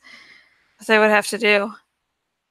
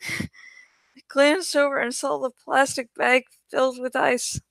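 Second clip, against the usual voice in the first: ordinary delivery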